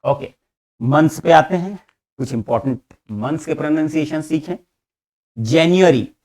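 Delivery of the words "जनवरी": "जनवरी" clear and louder, falling; model word. Shown as a demonstration. Teacher modelling January for students to repeat. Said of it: "please repeat after me".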